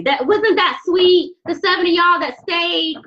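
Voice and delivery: overflow with sweetness at the message; gushing. Real, not acted. Tarot reader gushing to her viewers about the sweet reading.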